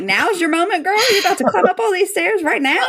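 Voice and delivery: cheering her on. Retold or imitated. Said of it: "I'm like".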